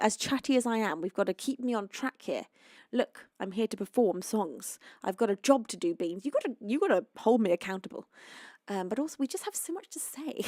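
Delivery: usual speaking voice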